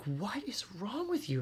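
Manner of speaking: high, soft, drawn-out disbelief; mock bafflement at yourself; exasperated self-scolding. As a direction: chide yourself in a high, hushed voice. Retold scolding of own nerves.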